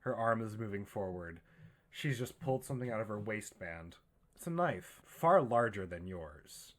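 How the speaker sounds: ordinary delivery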